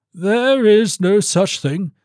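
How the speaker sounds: high, drawn-out indignant denial; slow, high-pitched professional umbrage; offended baron. Acted. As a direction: dismiss it slowly in a high baron's voice. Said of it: "beginning to feel that his professional reputation".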